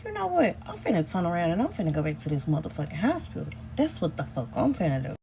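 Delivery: low, soft, fast muttering to herself; hushed rapid resolve; muttered decision. Retold or imitated. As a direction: quietly make up your mind. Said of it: "I was like".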